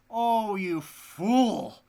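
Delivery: slow, loud, high scorn; scornful wife. Acted. The wife mocks her husband, slow and loud. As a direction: sneer it out slowly.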